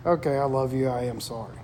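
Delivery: low, soft reluctant apology; grudging apology. Retold or imitated. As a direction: mumble a reluctant sorry. Kids finally apologizing, laughing.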